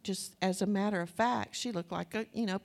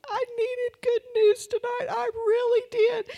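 loud, very high hammed-up wail; boo-hooing; 13 semitones higher, 8 dB louder than usual. Woman admitting she needed good news, loud imitated boo-hoo wail. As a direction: wail it out, imitating a tearful outburst. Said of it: "boo-hooing at this point".

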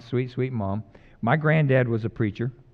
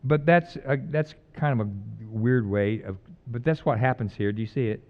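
normal talking voice

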